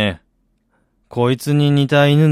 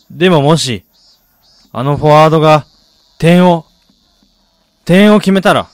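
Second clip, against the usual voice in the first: building from hopeful to near shouting, higher and louder; rising excitement; 6 semitones higher, 8 dB louder than usual. Acted. Man watching the forward, voice climbing with hope. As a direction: let the hope swell until it bursts. Watching the match's final moments.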